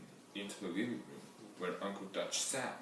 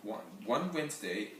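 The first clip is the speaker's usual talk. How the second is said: usual speaking voice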